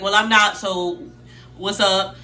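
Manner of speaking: loud, punchy defensive comeback; defensive retort. Retold or imitated. Retells his defensive comeback in argument.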